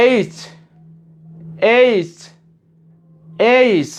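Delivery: এইচ with falling glide and crisp final ch; model pronunciation. Shown as a demonstration. Teacher models the next letter for learners, careful এইচ. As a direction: say এইচ separately and fully. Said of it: "এরপরে আমরা যাচ্ছি এইখানে".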